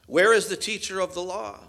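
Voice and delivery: sarcastic. Retold or imitated. Paul taunting the scribes, loud sarcastic challenge. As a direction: call out for a teacher who won't come. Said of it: "the sarcasm in Paul's voice here".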